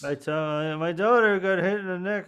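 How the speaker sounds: talk like this. Pretend. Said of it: "I talk like this".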